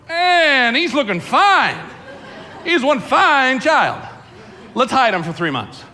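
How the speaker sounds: high, loud, slow mock admiration; mock-admiring mother. Mother gazing at her newborn, hammed-up admiring exclamation. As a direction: ham up a mother admiring her fine baby. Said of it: "Did the mother look at him and go".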